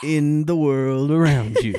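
slow, dramatic trailer narration; movie trailer voice. Acted. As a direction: intone it like an epic film trailer. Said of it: "like, a, a movie trailer voice there".

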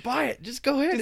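high, loud carefree dad; jolly dad. Retold or imitated. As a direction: wave it through like a big spender. Generous dad voiced.

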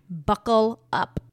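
stretched out and punched; slow emphatic. Acted. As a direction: drag out "Buckle up" with weight. Catchphrase said slow and heavy.

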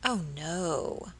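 cold, clipped denial; chilling. Acted. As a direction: let it fall cold and final. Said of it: "replied Miss Tonks with a chilling accent".

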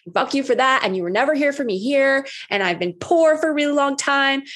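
furious outcry, retold; raging at the universe. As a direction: let the old rage out at the universe. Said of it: "I was like".

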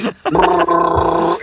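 long rolling "brrr" of shaking speakers; imitated bass rumble; subwoofer rumble. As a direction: buzz your lips like an earthquake rumble.

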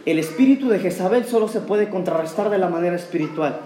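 low, soft prayerful preacher voice over music; altar call prayer. Acted. Pastor inviting the congregation to pray, soft and low. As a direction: gently lead the church into prayer.

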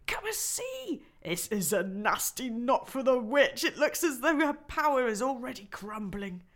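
high, loud, gleeful voice; nearly dancing with joy; delighted beaver. Acted. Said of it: "almost dancing with delight".

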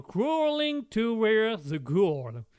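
slow, loud, hammed-up English title; over-the-top English accent, slow; mangled English accent. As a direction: drag out the title in a mock American voice. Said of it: "avant de massacrer l'entièreté de l'anglais".

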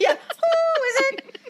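high, slow parrot squawk through a laugh. Acted. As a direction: ham up the nosy parrot. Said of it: "parrot from the sketch".

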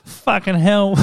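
high-pitched broad Australian swearing; rougher Australian accent. Retold or imitated. Mocking interstate speakers for co-host, broad Australian accent. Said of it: "Versus like".

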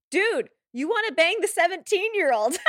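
loud, high heckle in disbelief; mock outraged shout. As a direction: shout it like an appalled husband at the screen. Voicing husband's outraged heckle at Brandon.

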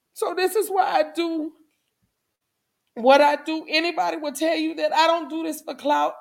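choking up while urging them on; real emotional. Real, not acted. Speaker encouraging viewers from hard experience, emotional and tearful. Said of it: "getting so emotional".

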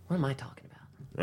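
whispered confused aside; whispering. Real, not acted. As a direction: whisper it to yourself.

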